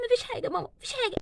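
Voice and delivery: crying daughter telling her mother it's nothing; tearful. Acted. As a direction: whimper it out to mum.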